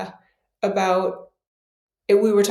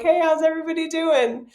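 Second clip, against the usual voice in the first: overeager, higher, bright hello; forced cheerful greeting; 7 semitones higher than usual. Retold or imitated. Insecure version of herself greeting everyone, forced cheer. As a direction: fake breezy to hide nerves. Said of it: "I'm not relaxed. I'm not calm".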